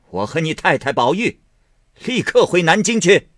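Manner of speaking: high, loud decree; angry command. A narrator's reading. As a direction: announce it in a fury. Grandmother orders departure.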